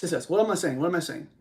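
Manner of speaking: usual speaking voice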